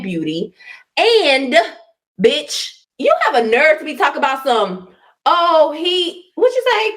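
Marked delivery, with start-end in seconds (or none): 5.2-7.0 high, sneering mock of her words; mocking quote. Mocking the other woman's words.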